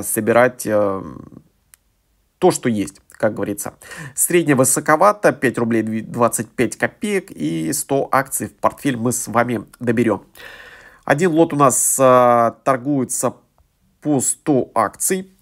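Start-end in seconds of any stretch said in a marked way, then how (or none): none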